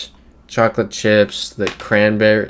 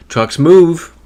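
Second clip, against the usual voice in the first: higher, louder outburst; loud exclaim; 8 semitones higher, 9 dB louder than usual. Real, not acted. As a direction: blurt it out loud and high. Repeats point, first louder.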